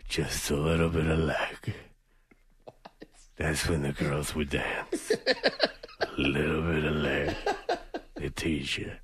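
put-on very low, hushed old-timer voice; doddering old man. Host parodies old pianist on dancing girls, low hushed mumble. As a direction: murmur memories like an old man.

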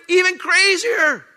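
high, loud, slowed exclamation; excited shout. Acted. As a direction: cry it out high and slow. Preacher exclaims at the top.

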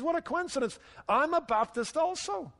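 high-pitched, eager discovery of common ground; mock delighted surprise. Rescuer to man on the bridge in a joke, mock delighted.

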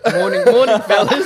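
high, loud "morning fellas"; groggy morning greeting. Retold or imitated. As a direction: belt out a hungover hello. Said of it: "He's like".

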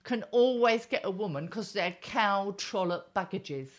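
loud, high-pitched opening, then railing on; angry rant. Real, not acted. Betrayed wife ranting about men always getting women, angry. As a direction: spit out a scornful tirade.

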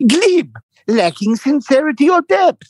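high, slow dictionary definition; hammed-up lecturing voice; mimicking him reciting. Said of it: "He goes".

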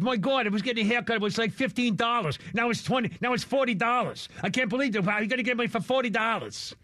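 a lightly put-on New York gripe; New York accent. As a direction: whine it like a Gen Z guy from New York. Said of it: "they talk like this".

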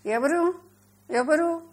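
high-pitched, loud query on waking; startled questioning. A narrator's reading. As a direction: call out as if just shaken awake. Boy woken from deep sleep.

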